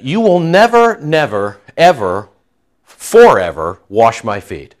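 never, never, ever, forever, each word stressed; emphatic refusal. Retold or imitated. Preacher voicing Peter refusing Jesus, stressed nevers. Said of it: "Peter said".

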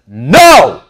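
No punched loud and drawn out; emphatic NO. Acted. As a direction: hit No hard, as a forceful rebuke. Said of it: "That's the emphasis".

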